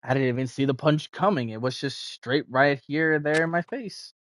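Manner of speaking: usual speaking voice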